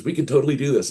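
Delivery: imitating a pumped-up friend; eager insistence. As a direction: sell it like it's a sure thing.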